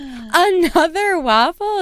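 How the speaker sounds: high, slow snooty "Another waffle?"; mock outrage. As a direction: ham up the petty complaint. Imitating the waffle complainer.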